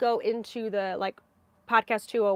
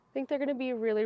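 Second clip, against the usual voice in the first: ordinary talk, nothing marked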